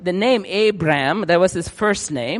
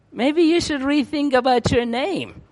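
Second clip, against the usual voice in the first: higher, teasing advice; mock teasing; 8 semitones higher than usual. Townsfolk tease Abraham about his name, higher and mocking. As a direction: needle him with a grin.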